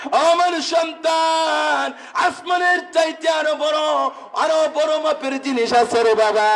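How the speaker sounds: high, long-drawn chanted cry; father calling out. Acted. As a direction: call out at a high pitch, stretching the words. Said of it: "ডাক দিয়ে বলে".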